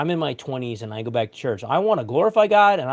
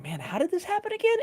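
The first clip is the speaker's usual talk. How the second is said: mock disbelief; 4 dB quieter than usual. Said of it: "and I'm like".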